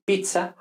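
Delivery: pizza as pitsa, with a t-s; Italian zz. Shown as a demonstration. Teacher contrasts two sounds, pizza as pitsa. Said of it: "vemos que no es lo mismo".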